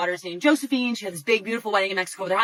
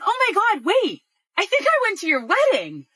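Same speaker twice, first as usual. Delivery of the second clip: high, excited voice of sudden recognition; sudden realization; 10 semitones higher, 3 dB louder than usual. Retold or imitated. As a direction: blurt it out as it dawns, pitched up.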